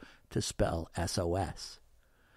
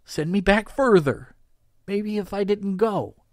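desperate; 8 semitones higher, 5 dB louder than usual. A narrator's reading. Desperate plea after breaking.

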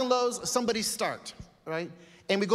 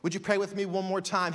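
ordinary talk, nothing marked

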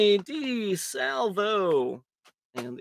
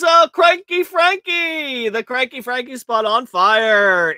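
excited call; 6 semitones higher, 8 dB louder, slower than usual. Real, not acted. Announcing the big hit.

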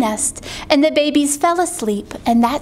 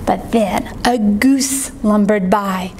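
ordinary delivery

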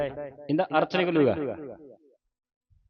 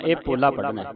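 normal talking voice

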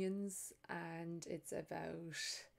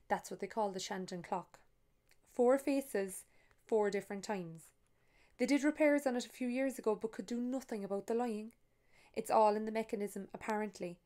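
story narration; 2 semitones higher, 6 dB louder than usual. A narrator's reading. Author reading her novel to an audience, lively storytelling. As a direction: read it to the room like a storyteller.

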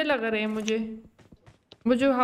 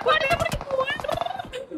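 slow, much higher alarmed wail; 12 semitones higher, much slower than usual. Real, not acted. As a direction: stretch it out in alarm. Being shot, drawn out.

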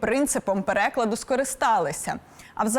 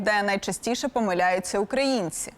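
normal talking voice

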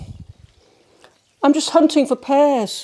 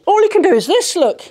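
normal talking voice

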